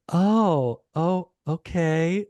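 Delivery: high, drawn-out mock realization. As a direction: imitate a star slowly catching on. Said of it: "she's like".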